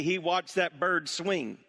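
usual speaking voice

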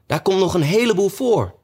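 high, fussy objection; hasty interjection. A narrator's reading. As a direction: protest in a high rabbit voice. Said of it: "kwam het konijn haastig tussen beiden".

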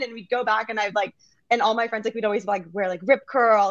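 ordinary talk

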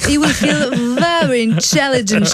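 slow, put-on British English; mock British accent. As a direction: drawl it out like a British presenter. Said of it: "l'accent british". (accent britannique imité, lent et moqueur)

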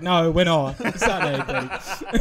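firm but laughing; laughing insistence. Retold or imitated. Insisting, repeating.

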